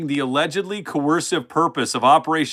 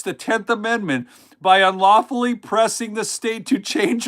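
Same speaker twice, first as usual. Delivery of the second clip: incredulous re-read; 4 semitones higher than usual. Shown as a demonstration. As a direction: read it back as if it's absurd. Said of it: "Listen to this".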